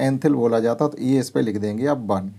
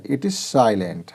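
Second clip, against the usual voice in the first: slow emphatic; much slower than usual. Shown as a demonstration. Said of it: "teacher stressing silent letter".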